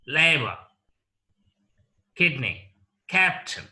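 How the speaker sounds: "English", "doctor", "neighbor" and the rest, strong opening syllable, falling second; first-syllable stress. Shown as a demonstration. Teacher repeating noun examples like "doctor", stress on each first syllable. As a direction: drill "English" to "captain" with pauses, punching each first syllable. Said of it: "take stress on the first syllable itself".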